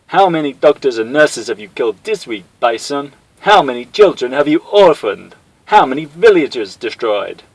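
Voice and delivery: loud, high furious accusation; angry accusation. Acted. Guile accusing Bison live on air, loud angry. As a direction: hammer each question.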